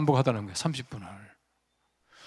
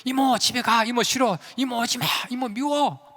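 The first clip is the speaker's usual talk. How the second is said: higher, mock screaming little boy; imitating a child screaming; 7 semitones higher than usual. Burned boy yelling at his aunt to leave, in a higher mock scream. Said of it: "막 소리소리 지르면서".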